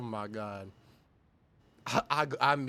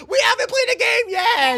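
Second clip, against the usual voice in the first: shouting through laughter; laughing shout; 15 dB louder than usual. Real, not acted. Streamer laugh-yelling at the video. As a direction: yell it while cracking up.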